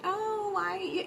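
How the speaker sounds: high whiny mock victim cry; playing the victim. Speaker mocking a partner who plays victim, high whiny. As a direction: whine it like an innocent victim. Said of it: "play the victim".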